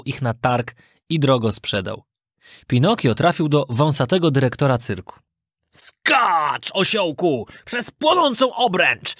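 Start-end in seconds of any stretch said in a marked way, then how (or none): 5.7-9.1 drawn-out ringmaster's order, rising high; commanding director. A narrator's reading. Circus director sending donkey through burning hoop, commanding. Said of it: "nakazywał mu dyrektor".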